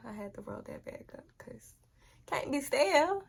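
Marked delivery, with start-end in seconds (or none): 0.1-1.7 soft ASMR whisper. Real, not acted. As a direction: whisper it close to the mic. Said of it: "A S M R for y'all".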